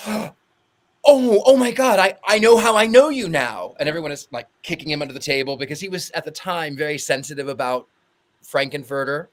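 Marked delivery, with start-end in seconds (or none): 0.1-3.7 loud, high burst of realisation; acted-out starstruck outburst; excited recognition. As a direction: exclaim as if it has just dawned on you. Friend suddenly recognises the actor.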